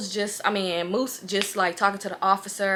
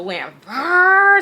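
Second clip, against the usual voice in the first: engine brrr; 7 semitones higher, 10 dB louder than usual. Retold or imitated. Engine noise imitation.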